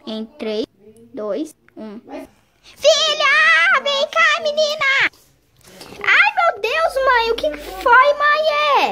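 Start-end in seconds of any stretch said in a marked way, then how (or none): 2.6-5.1 high, loud call to a daughter across the house; mom calling out. Pretend. Mother calling her daughter from another room, high call. 6.0-8.9 put-on high girl's voice, answering mom; lightly voiced young daughter; sleepy daughter. Daughter answering her mother who called, high voice.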